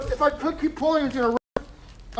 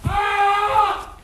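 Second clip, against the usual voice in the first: loud, higher cries of pain; pained screaming; 7 semitones higher, 6 dB louder, much slower than usual. Acted. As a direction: scream and demand a break. Pain, demanding a break.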